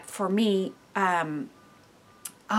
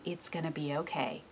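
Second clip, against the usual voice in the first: soft, lower, calming words; soothing reassurance; 5 semitones lower, 8 dB quieter than usual. Retold or imitated. Parent softly reassuring a crying child, soothing. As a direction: settle the child down gently. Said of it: "you're gonna be telling him".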